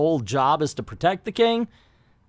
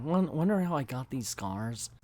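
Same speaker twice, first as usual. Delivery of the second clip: a light, much softer hint of the Joker; Joker line; 10 dB quieter than usual. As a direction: quietly tease the famous scar line. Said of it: "that little clip of the Joker".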